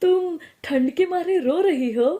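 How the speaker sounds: loud, high incredulous question; teasing disbelief. Acted. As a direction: exclaim in amused astonishment. Others tease the crying wife, then laugh.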